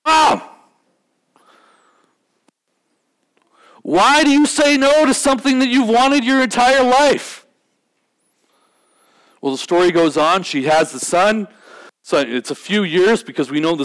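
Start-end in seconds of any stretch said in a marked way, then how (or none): 0.1-7.5 high-pitched, exasperated cry of disbelief; acted incredulous protest. Woman's protest to prophecy, acted.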